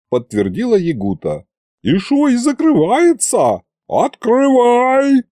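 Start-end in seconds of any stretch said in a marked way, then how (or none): 1.8-5.2 yelling at the locked door, slow and high; furious yell. Acted. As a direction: howl at the door in outrage. She yells at the door.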